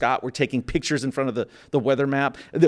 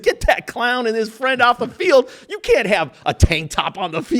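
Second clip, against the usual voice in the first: higher, louder, slowed shout of an angry owner; mock boss shout; 8 semitones higher, 5 dB louder than usual. Owner yelling from his box at the two intruders, mock angry shout. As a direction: bark the order down from the owner's box, hammed up.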